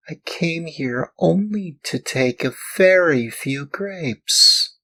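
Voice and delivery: put-on high tearful puppet boy; sobbing. A narrator's reading. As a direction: plead high through tears. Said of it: "sobbing bitterly".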